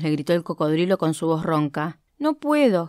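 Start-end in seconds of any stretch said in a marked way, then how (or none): 2.2-2.9 whimpering. Acted. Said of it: "gimió el león".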